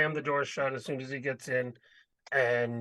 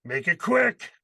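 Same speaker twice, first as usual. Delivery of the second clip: urgent order; 4 semitones higher, 8 dB louder, slower than usual. Acted. Urging someone through the door.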